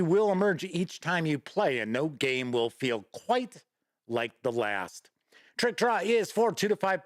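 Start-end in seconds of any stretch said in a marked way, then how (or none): none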